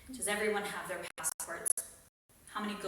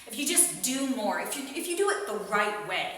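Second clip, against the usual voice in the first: mocking preachy advice; 4 semitones higher, 6 dB louder than usual. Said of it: "people are gonna tell you".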